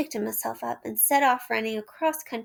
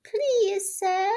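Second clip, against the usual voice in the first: washerwoman voice; 9 semitones higher than usual. A narrator's reading. Toad as washerwoman.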